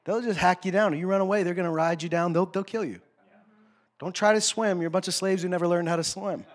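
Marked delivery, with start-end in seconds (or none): none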